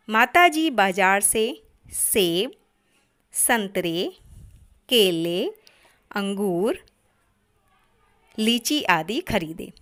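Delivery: slow list, a clear pause after सेब, संतरे, केले, अंगूर; pauses between list items. Shown as a demonstration. Teacher reads a comma example, pausing after सेब, संतरे, केले, अंगूर. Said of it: "एक जैसे शब्दों को अलग-अलग करने के लिए".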